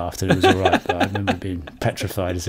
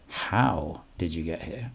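"How" drawn out slowly, the rest softer; stress on How; 4 semitones lower, 8 dB quieter, slower than usual. Shown as a demonstration. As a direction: lean long on "How". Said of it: "there's different ways".

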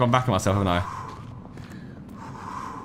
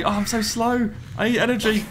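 ordinary talk, nothing marked

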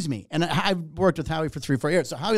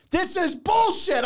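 high, drawn-out retold outburst; flipping out; 14 semitones higher, slower than usual. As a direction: re-enact losing it, stretched and high-pitched. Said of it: "so I'm flipping out".

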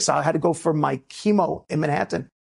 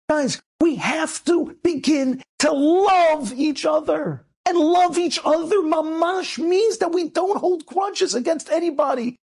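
fervent preaching; 8 semitones higher than usual. Real, not acted. Rabbi urging the congregation to love each other, fervent preaching. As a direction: preach it with full force.

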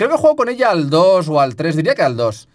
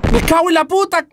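terrified curse; 14 semitones higher than usual. Real, not acted. Jump-scared in the woods, terrified curse. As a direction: scream the curse in panic.

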